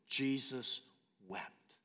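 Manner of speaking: soft and low, letting "wept" fade almost to nothing; hushed, grieving read; quiet, reverent delivery. Acted. Shortest verse, reverent hush.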